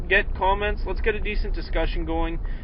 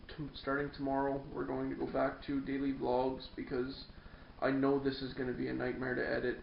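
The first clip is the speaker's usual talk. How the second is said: hushed; 2 semitones lower, 14 dB quieter than usual. Real, not acted. Quiet late-night sign-off, kids sleeping.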